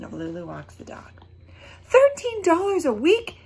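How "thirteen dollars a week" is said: high, loud incredulous question; outraged at the small pay; shocked disbelief. Acted. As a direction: gasp it out in disbelief.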